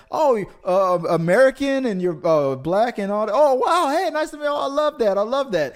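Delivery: high, gushing excited greeting; excited friend. Retold or imitated. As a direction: gush over meeting someone new. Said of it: "It was like".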